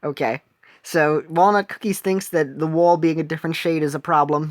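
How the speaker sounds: usual speaking voice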